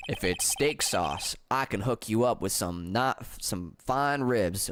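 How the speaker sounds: put-on lazy, low Southern accent. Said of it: "stop me from doing this damn accent".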